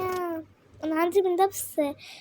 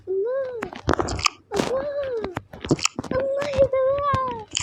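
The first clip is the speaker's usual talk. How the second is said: amazed; 10 semitones higher than usual. Real, not acted. Gamer amazed by a pretty level, crying out to viewers. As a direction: gasp out your wonder.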